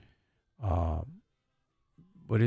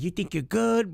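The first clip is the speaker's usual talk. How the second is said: mimicking her; 13 semitones higher, 4 dB louder than usual.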